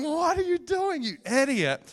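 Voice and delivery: high, loud scolding inner voice; hammed-up shrill rebuke from his own body; mock inner scold. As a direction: shriek at yourself like your own body. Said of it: "my body's going".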